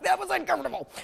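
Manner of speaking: awkward inner voice. Retold or imitated. Escaping a compliment, mildly mock awkward. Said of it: "because you're like".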